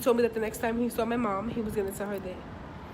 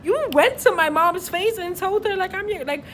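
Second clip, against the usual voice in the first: loud, high-pitched outraged retelling; worked-up, high, loud scolding; indignant telling-off; 8 semitones higher, 6 dB louder than usual. Retold or imitated. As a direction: tell him off, high and incredulous. Retold telling-off of boyfriend.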